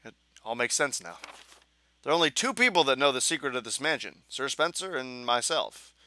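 dramatic diary reading; hammed-up dramatic narration; reading game diary. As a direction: read the note like a tense memoir.

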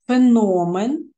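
"Феномен" slow, with a loud, long stressed О; stress on second syllable. Shown as a demonstration. Teacher checking word stress, "Феномен" stressed on О. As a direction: hit the О in "Феномен". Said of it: "наголошений другий склад, тобто звук О".

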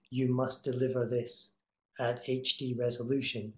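ordinary talk

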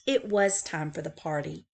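plain reading voice

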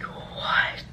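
breathless whispered what; stunned whisper. Retold or imitated. Reading Tyler's text about his girlfriend, stunned whisper. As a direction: whisper it in disbelief.

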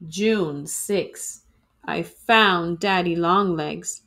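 ordinary delivery

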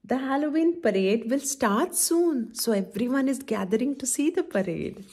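ordinary delivery

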